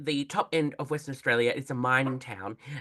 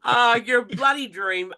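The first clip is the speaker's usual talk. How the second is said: loud, high gushing praise; excited gush; 8 semitones higher, 6 dB louder than usual. Real, not acted. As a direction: gush over her loudly.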